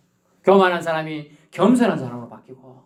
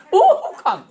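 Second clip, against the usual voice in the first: high, loud gasp and imitated bang; mock crash; 13 semitones higher, 5 dB louder, much slower than usual. Preacher playing the distracted driver crashing, mock startled bang. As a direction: cry out, then voice the impact.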